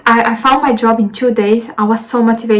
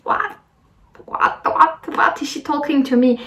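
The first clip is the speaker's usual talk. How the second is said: higher, softened mock confusion; acted bewilderment; 4 semitones higher, 5 dB quieter than usual. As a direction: replay a stunned, baffled reaction under the breath. Acting out her baffled reaction.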